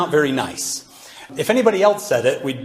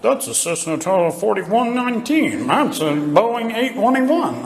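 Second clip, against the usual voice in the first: higher, slow, nerdy spotter voice; imitating the airplane guru; 5 semitones higher, slower than usual. Mimicking his plane-spotting friend at the airport, nerdy expert voice. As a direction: drag out the plane names like an expert. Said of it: "He's like".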